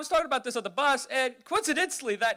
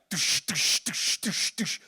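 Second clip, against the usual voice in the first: heavy footsteps; 3 semitones lower, much slower than usual. Retold or imitated. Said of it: "it's like".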